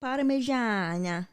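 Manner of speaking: slow Italian accent, savoring it. Shown as a demonstration. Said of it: "Pretty good Italian accent there".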